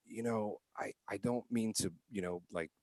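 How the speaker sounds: soft, polite and careful; very politely. Retold or imitated. Man politely warning a mother beside him, soft polite voice. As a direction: tiptoe around it gently. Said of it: "very, very politely".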